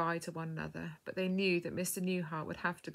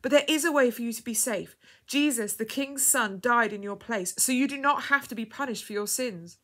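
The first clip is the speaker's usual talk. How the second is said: louder, higher, earnest; urgent explanation; 4 semitones higher, 7 dB louder than usual. A narrator's reading. Woman offering the dying girl hope, urgent and earnest. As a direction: insist with pressing hope. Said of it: "spoke quickly".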